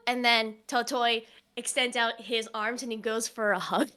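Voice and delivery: ordinary talk, nothing marked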